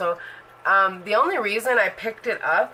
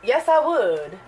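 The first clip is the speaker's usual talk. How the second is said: eager yes; 5 semitones higher, 3 dB louder, slower than usual. Retold or imitated. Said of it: "How did that go?".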